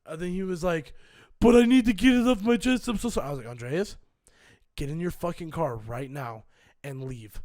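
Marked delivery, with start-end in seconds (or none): 1.4-3.3 high, loud mock plea; exaggerated apology; imitating tearful pleading. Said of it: "he's in tears". 3.5-7.4 low, measured command; retold low warning; controlled threat. Retold final warning to Andreas.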